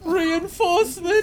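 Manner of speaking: very high, stretched-out cry; long, slow mock-dramatic holler; hammed-up call. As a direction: call desperately for help, overdone. Calls out as the crawling soldier.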